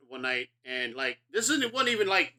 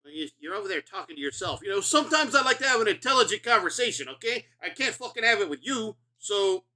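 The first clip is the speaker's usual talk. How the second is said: louder, higher mock-defensive retort; playfully defensive, pitched up and loud; defensive snap; 7 semitones higher, 5 dB louder than usual. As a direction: brush them off, hammed-up indignant. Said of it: "I immediately get defensive".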